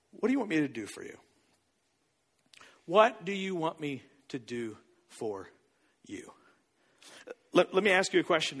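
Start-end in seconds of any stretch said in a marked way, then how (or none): 0.1-1.2 low, soft, fast-flowing question; soft quick question. Retold or imitated. Jesus asks the blind man, soft quick question. 2.9-6.3 word by word, with pauses, low. Retold or imitated. Repeats question slowly for weight.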